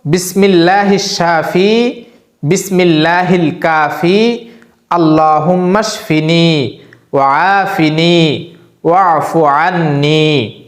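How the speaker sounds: slow, drawn-out Arabic prayer recitation; dua recitation. Shown as a demonstration. Imam teaching a healing dua, slow recitation. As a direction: recite each phrase slowly so they can memorise it. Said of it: "দোয়াটি হলো".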